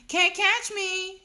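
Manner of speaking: put-on high, loud toddler taunt; hollered taunt. A narrator's reading. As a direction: holler it drawn out, daring them to chase. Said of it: "he hollered".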